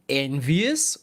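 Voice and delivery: usual speaking voice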